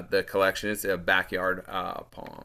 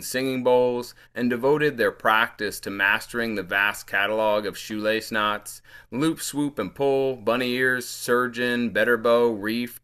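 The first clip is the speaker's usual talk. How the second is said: lifted, deliberate poem recital; poetry reading; 3 semitones higher, 5 dB louder than usual. Acted. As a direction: read each phrase out as verse. Reads own poem aloud.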